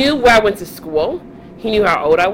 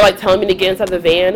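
ordinary talk, nothing marked

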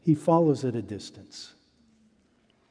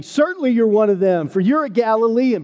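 accusing crowd; 8 semitones higher, 4 dB louder than usual. Acted. Bystanders accuse Peter.

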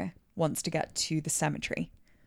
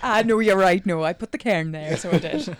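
mock Irish accent; 8 dB louder than usual. Teasing Josh about cairns, hammed-up Irish accent. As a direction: play a cheery Irish camper. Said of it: "in an Irish accent".

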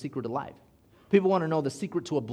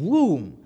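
drop whoosh; 2 semitones higher, 8 dB louder than usual. Retold or imitated. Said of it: "we dropped again".

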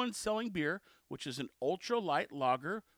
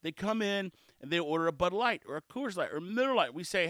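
ordinary talk

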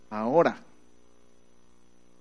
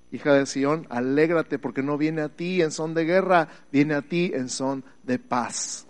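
ordinary talk

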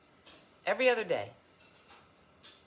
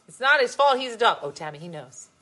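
ordinary talk, nothing marked

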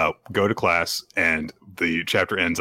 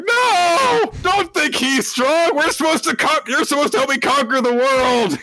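desperate Anya; 4 dB louder than usual. Retold or imitated. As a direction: plead like a tiny frantic kid. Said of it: "And Anya's like".

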